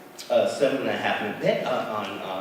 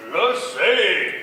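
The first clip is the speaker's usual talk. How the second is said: small spider voice; 10 semitones higher, 8 dB louder than usual. Acted. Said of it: "said the spider".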